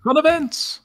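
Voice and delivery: high, sudden call; calling out in character. Acted. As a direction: sing it out high to a companion. Player calls out in character.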